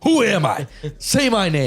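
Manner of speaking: loud, high-pitched demand; playful, over-the-top challenge; mock demanding shout. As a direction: shout it like a hammed-up showdown. Mock demand to grandpa, playing pool.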